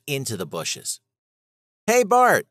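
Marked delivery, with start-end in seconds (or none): 1.9-2.5 high, loud call of a name; yell. Acted. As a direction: shout at him to stop. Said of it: "Charlie yelled at me".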